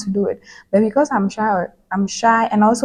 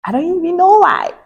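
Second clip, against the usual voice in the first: high, louder exasperated outburst; 10 semitones higher, 5 dB louder than usual. Real, not acted. Vlogger questioning the pressure she puts on herself, exasperated. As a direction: throw it up high in disbelief at yourself.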